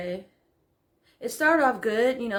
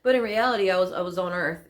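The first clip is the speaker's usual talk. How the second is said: normal talking voice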